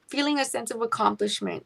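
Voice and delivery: normal talking voice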